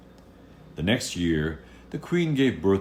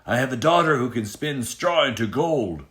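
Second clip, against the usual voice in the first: raised, loud bragging voice; boastful tailor; 8 semitones higher, 6 dB louder than usual. Acted. Tailor bragging to the king, loud and proud. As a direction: puff up and brag to the king.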